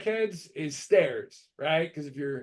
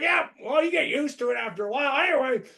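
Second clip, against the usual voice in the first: shrugging buddy; 7 semitones higher, faster than usual. Retold or imitated. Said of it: "and he's just like".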